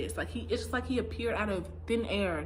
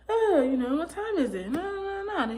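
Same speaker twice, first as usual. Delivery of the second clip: impatient guys; 8 semitones higher, 4 dB louder than usual. Retold or imitated. Voicing the impatient waiting guys.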